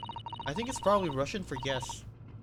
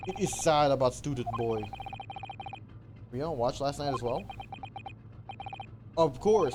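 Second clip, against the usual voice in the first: put-on Russian sailor accent. Sailor boasting about the first class area, Russian accent. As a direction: puff up like a guard in Russian accent.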